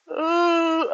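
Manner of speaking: high, drawn-out mock yawn; imitated yawn. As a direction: act out a yawn in public. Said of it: "I can yawn whenever".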